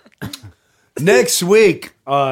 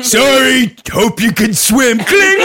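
higher gleeful taunt; mocking villain; 7 semitones higher, 3 dB louder than usual. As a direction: taunt them cheerfully. Pirate cutting the cord.

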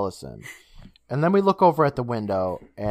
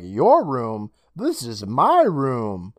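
"my" stretched and pitched up in protest; indignant stress; much slower than usual. Acted. Boy to intruder in his room, indignant stress on "my". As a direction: punch YOUR, then MY, stretched out.

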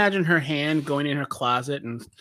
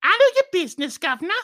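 high, hammed-up Cockney; exaggerated high British voice; mock British accent; 12 semitones higher, 3 dB louder, much faster than usual. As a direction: squawk it like a cartoon Cockney.

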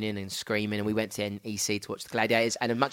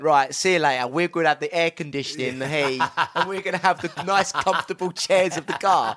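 parents gloating; 3 semitones higher, 4 dB louder than usual. Retold or imitated. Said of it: "Locked us up and went".

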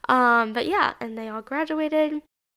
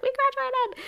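much higher, bright cheer; excited announcement; 14 semitones higher than usual. Retold or imitated. Telling student media friends, high excited cheer. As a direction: squeal it out proudly. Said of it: "and was like".

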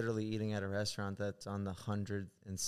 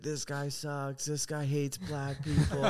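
raised, drawn-out mock accusation; mock screaming; 5 semitones higher, slower than usual. Beggar accuses a tourist who turned him down, mock screaming. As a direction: imitate a heckler slowly calling someone out.

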